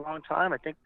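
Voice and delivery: ordinary delivery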